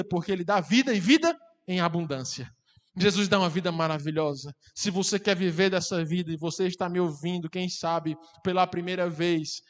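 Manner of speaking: ordinary talk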